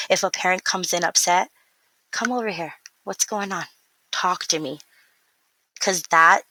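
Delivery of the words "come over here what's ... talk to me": softer, calm come-here; soft soothing welcome; gentle invitation. Retold or imitated. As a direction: coax an upset parent in quietly. Comforting an upset parent.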